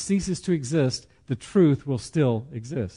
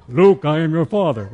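louder, hammed-up Vader line, laughing; Darth Vader impression; 2 semitones higher, 7 dB louder than usual. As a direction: boom out the villain's reveal. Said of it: "sounds like that".

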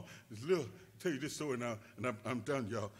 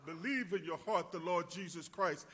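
ordinary talk

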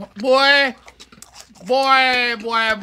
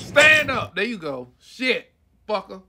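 normal talking voice

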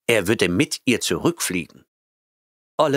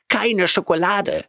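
very high, louder cat shriek; 13 semitones higher, 3 dB louder than usual. A narrator's reading. Cat Oliver shrieking as he bolts from Grant. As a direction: yelp it out in panic. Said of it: "schrie er".

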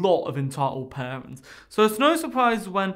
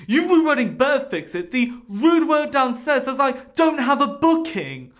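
angry mother; 6 semitones higher, 5 dB louder than usual. Retold or imitated. Entitled mother complains.

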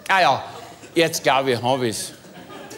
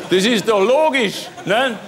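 emphatic insistence; 9 semitones higher, 5 dB louder than usual. Real, not acted. Insists after laughing.

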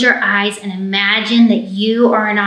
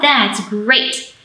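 usual speaking voice

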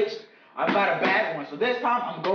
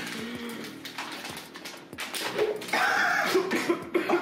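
choking cough; 3 semitones higher, 5 dB quieter than usual. Real, not acted. Went up my nose.

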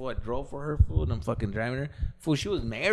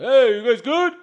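husband calling out; 10 semitones higher, 10 dB louder, slower than usual. Retold or imitated. Husband calls out from house.

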